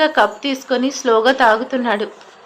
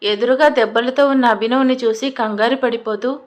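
usual speaking voice

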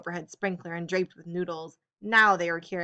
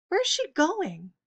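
high, slow puzzled question; surprised; 10 semitones higher, slower than usual. A narrator's reading. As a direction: ask it higher and slower, baffled.

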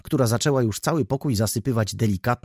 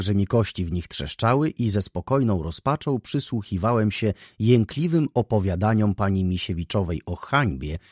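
plain reading voice

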